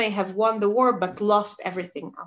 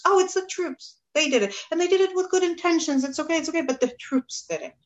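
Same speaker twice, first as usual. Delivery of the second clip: mock excuse; 8 semitones higher, faster than usual. Teacher voices Saul blaming troops.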